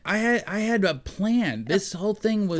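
high-pitched, outraged mock God; indignant God. God scolding them for killing his son, mock outrage. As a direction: play God aghast at a ruined plan. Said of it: "and then God comes down".

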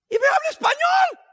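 high, loud cry of surprise; astonished outburst. Retold or imitated. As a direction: blurt it out, amazed. Chinese man suddenly speaks Spanish.

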